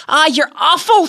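high-pitched mother protesting through her amusement; imitated mother, high and mock-outraged; amused mother's protest. A narrator's reading. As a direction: scold the joker in a high, delighted voice. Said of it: "she would say".

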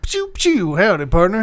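hammy western drawl hint; cowboy voice for a game clue; fake cowboy accent. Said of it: "I'll give you a hint".

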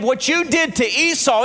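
ordinary talk

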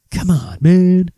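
slow, mock Joe Biden catchphrase; Biden impression. Host mimics Joe Biden's catchphrase, slow and drawn. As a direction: drag out the man like an old politician. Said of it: "that's his favorite thing".

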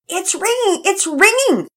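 put-on high, loud boy's squeal of excitement; boy shouting at the ringing phone, thrilled; excited shout. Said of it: "Pearson shouted excitedly".

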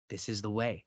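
soft, reverent and low; respectful. Retold or imitated. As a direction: say it with quiet respect. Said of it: "in the most respectful, like, beautiful way to her".

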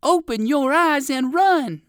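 high, loud, stretched-out shout; calling out. A narrator's reading. Poodle doggy tells the rabbit to flee, calling out. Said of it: "called Fido Flip Flop".

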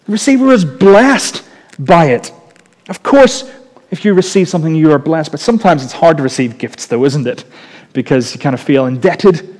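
normal talking voice

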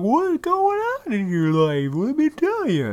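high, slow, loud put-on voice; mocking nosy cousins. Mimicking long-unseen cousins asking questions, mocking high voice. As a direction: drag it out in an exaggerated high voice. Mocks cousins' small talk.

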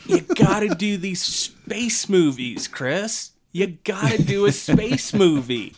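high, loud hammed-up urging; theatrical actor talking a friend into it; imagined actor friend. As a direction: push a colleague insistently, in a put-on voice. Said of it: "That's gotta be the conversation".

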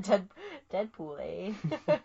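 slow drawn-out Canadian "Deadpool, eh?"; Canadian twang with a rising eh; lightly done Canadian accent; mock Canadian. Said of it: "terrible Canadian accents".

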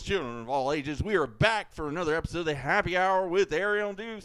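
big booming show intro; announcer voice. Acted. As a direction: announce it like a ringmaster. Opens the show as host.

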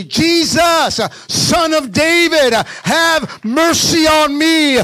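loud, high preacher's cry for mercy; full shout. Acted. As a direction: shout it out as the desperate beggar. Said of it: "I'm gonna shout and I'm gonna say".

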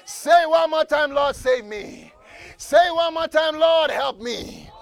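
loud, high, shouting preacher climax; full-force preaching. Acted. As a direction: build the congregation up to cry out.

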